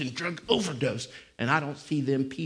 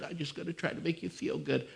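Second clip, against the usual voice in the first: mock feel-good preacher; 4 semitones higher, 7 dB quieter than usual. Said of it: "Mister Feel Good".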